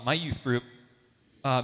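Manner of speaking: ordinary talk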